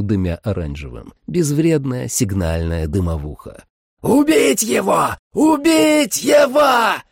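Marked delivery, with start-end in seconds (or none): 4.0-5.2 high, slow screaming vampire. A narrator's reading. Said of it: "заорал Дарне". 5.3-7.0 very high, slower, louder shriek; louder vampire scream. A narrator's reading. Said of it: "заорал Дарне".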